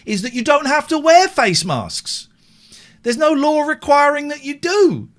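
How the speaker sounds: mock his outrage in a high, loud whine; mocking mimicry.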